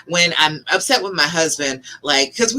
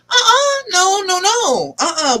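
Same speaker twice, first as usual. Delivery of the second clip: emphatic refusal; 10 semitones higher than usual. Acted. Wife refusing to let fear win, emphatic refusal.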